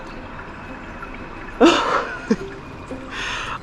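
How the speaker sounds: soft unvoiced giggle of joy; airy delighted laugh; breathy happy laugh. Real, not acted. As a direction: laugh out pure happiness. Happy in the garden.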